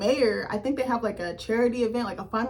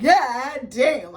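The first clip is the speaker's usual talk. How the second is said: loud, excited exclamation; getting loud; 4 semitones higher, 6 dB louder than usual. Retold or imitated. Reacting to the proposal on the show, loud. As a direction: let it burst out in disbelief. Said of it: "I'm sorry, I'm getting loud".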